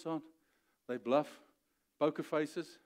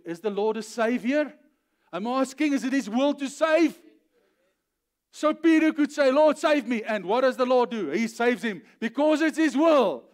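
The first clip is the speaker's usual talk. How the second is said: loud, raised-pitch shouting sermon; full-force preaching; 7 semitones higher, 10 dB louder than usual. Real, not acted. Preacher proclaiming the Lord's will to save, full force. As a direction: proclaim it to the whole congregation.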